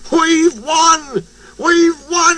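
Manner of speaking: high, jubilant cry; excited shout. A narrator's reading. Fireman announces the win, high excited shout. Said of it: "he shouted".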